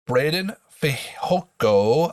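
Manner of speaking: normal talking voice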